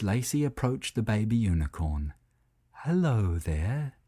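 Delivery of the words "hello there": slow, softer calm greeting. A narrator's reading. Old cow calmly greeting a frightened baby unicorn. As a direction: ease in gently so you don't scare it. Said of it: "said Lacey very calmly".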